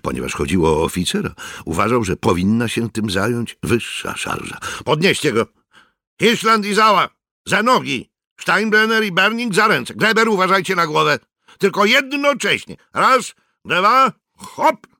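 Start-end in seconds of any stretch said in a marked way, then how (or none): 4.8-14.9 high, loud barked orders; rally the men to lift; excited sergeant ordering the lift, higher and louder; excited commands. Acted. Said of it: "Mikke był wyraźnie podniecony".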